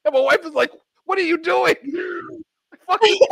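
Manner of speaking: high, through laughter; real laughter behind the words; laughing. Real, not acted. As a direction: still cracking up as he tells it. Retelling while still laughing.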